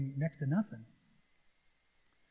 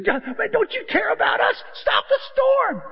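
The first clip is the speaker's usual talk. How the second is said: much higher, louder desperate cry; panicked plea; 6 dB louder than usual. Retold or imitated. Disciples shaking Jesus awake, frantic high shouting. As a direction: shout in terror at the sleeper. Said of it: "they're waking him".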